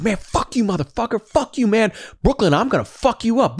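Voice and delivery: high mock tirade of curses; imitating a furious rant. Retelling Tony cursing out his friend, a high mock rage. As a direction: ham up a man losing it, pitched high.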